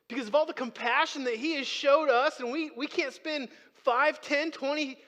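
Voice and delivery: loud, high pleading that climbs to a question; impassioned preaching. Real, not acted. Preacher asking why we can't spare minutes daily, impassioned preaching. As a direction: drive the challenge home to the crowd.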